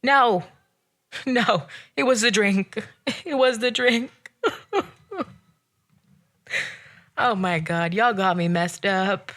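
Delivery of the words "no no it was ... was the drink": laughing through the words. Real, not acted. Laughing as she insists to viewers it was the drink. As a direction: giggle out the excuse. Laughing at her own sweaty-hands joke.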